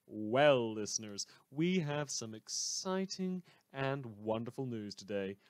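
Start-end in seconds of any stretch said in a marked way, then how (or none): none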